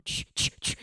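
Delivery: soft breathy shush. Real, not acted. Streamer hushes chat while her dad is near. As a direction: hush the room. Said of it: "Chat, quiet".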